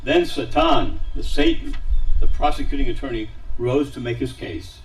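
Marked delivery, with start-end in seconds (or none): none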